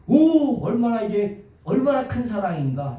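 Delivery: high, marvelling "오"; exclamation of wonder. Shown as a demonstration. Preacher exclaiming the verse's meaning to his church. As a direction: cry out at how great the love is. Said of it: "감탄문이야".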